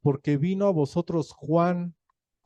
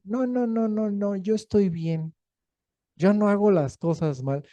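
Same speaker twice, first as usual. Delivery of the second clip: mock denial; 6 semitones higher than usual. Person in denial refusing repentance.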